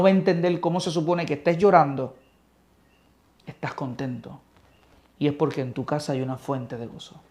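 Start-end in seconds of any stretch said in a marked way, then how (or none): 3.5-4.4 whispered aside. Real, not acted. Preacher telling listeners about hidden joy, whispered. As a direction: breathe it like a secret.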